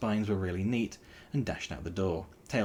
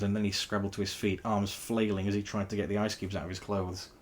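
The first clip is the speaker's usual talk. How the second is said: ordinary delivery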